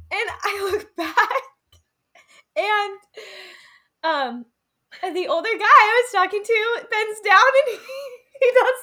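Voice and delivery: words squeezed out between high laughs; can barely get the story out for laughing; laughing through it. Real, not acted. Laughing while telling the story.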